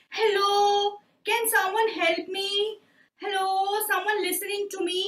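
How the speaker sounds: high voice calling out for help; a lost little dragon calling into the dark. Acted. Lost dragon calls for help, high calling voice.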